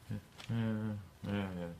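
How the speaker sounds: normal talking voice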